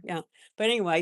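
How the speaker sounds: ordinary talk, nothing marked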